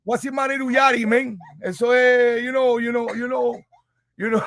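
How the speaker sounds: put-on light Cuban accent; teasing Cuban uncle. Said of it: "That was Cuban?".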